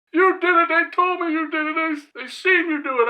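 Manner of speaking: high, loud wailing protest; mock tearful accusation. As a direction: imitate him blubbering out the accusation. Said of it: "This motherfucker was crying".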